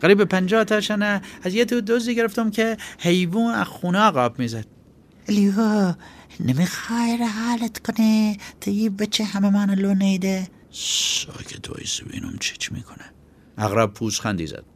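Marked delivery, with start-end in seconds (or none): 0.0-4.7 put-on high, loud boy's voice, blurting it out; a kid jumping in with both feet; daring boy. Chaghak's next lie. 5.3-10.5 high, anxious voice begging him to act; urgent pleading. Acted. Ayoub urging Baksur Ali. 10.7-13.0 low, slow whisper to keep him quiet; hushed command. Acted. Baksur Ali hushing Ayoub.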